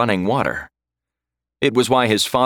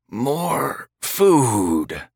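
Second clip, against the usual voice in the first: slow, hungry corpse rasp; undead creature voice; 4 semitones higher than usual. A narrator's reading. The Draugr eyes Jonathan, hungry creature voice. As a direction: drag it out like a dead thing. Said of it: "the creature said".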